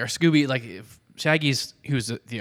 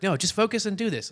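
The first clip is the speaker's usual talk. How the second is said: insistent; 8 semitones higher than usual. Acted. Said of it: "he's like".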